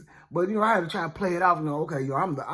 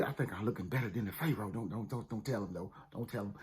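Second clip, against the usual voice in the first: hushed aside; 7 semitones lower, 11 dB quieter, faster than usual. Acted.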